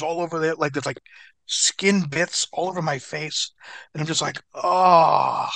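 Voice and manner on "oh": loud, drawn-out "oh"; horrified groan. Retold or imitated. Seeing skin bits in the mirror, acted groan. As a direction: groan it out in mock horror.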